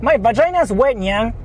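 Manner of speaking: high mock catgirl voice ending in Nyang; mock cutesy moan. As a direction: play a cutesy catgirl donor, teasing. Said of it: "Asta oohing".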